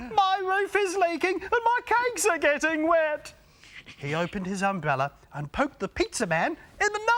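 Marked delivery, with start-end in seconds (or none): 0.1-3.3 high, loud alarmed cry; upset baker. Acted. Baker stepping out worried about his wet cakes, high alarm.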